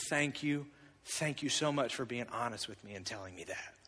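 softly thanking his daughter after a hug; tender thanks. Retold or imitated. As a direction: thank her gently and softly. Hugging daughter, grateful.

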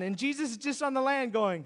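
ordinary talk

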